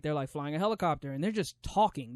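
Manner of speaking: usual speaking voice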